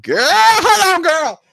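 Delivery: high, loud, slow call to wait; excited warning while watching the fight; urgent hold on. Retold or imitated. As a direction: stretch it out, high and alarmed. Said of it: "I said".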